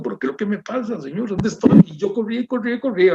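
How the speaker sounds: high, breathless-paced mock desperate plea; pleading in remembered panic; retold panic. Panicked plea while lost in darkness.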